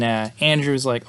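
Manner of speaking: ordinary talk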